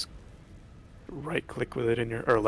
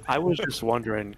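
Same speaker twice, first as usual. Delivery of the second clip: ordinary talk, nothing marked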